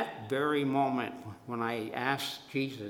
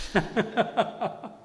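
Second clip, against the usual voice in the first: laughing; 8 semitones higher than usual. Real, not acted. Laughing at the memory.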